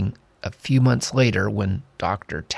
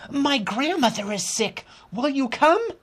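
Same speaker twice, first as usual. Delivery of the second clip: put-on high, pleading girl; young girl voice. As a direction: ask like a worried girl. Lena asks doctor for help.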